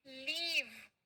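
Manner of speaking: लीव with a drawn-out long ee; long-vowel leave. Shown as a demonstration. Teacher showing class how it is pronounced, लीव with long ee. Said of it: "इसको कैसे बोलेंगे".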